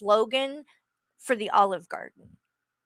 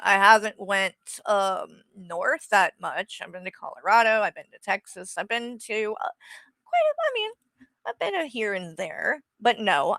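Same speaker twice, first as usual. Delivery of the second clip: normal talking voice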